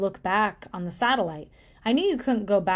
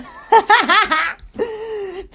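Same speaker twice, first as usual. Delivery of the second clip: high laugh; 12 semitones higher, 9 dB louder than usual. Real, not acted. Laughs after scaring viewers.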